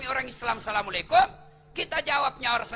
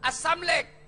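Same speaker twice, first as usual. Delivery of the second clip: student calling out; 6 semitones higher, 3 dB louder than usual. Retold or imitated. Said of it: "mahasiswa saya berteriak".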